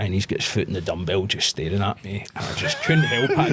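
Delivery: ordinary talk, nothing marked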